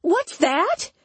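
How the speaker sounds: high, strained disgust; disgusted question. A narrator's reading. Imogen hearing of D H S, disgusted and high. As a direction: pitch it way up in disgust. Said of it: "face twisted into disgust".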